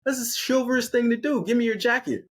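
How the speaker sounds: high-pitched, put-on demanding girlfriend; hammed-up high insistence; entitled Amy voice. Amy's acted entitled demand.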